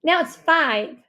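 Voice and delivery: loud, high, proud announcement; proudly. A narrator's reading. Rishi correcting the host, proudly. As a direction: pipe up proudly. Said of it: "Rishi said proudly".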